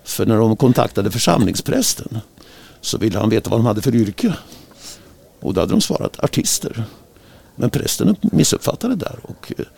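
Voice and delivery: ordinary talk, nothing marked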